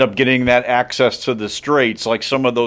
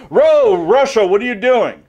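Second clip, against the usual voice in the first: high, loud mock shout of surprise; mock shocked call-out; 11 semitones higher, 6 dB louder than usual. Everybody catching Russia moving on the Straits, high mock outrage. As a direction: gasp it out like catching someone red-handed.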